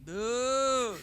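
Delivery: high, stretched-out protest; dismayed no. Real, not acted. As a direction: groan out a disbelieving no. Dreading a sports question.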